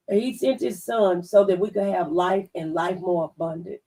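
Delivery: usual speaking voice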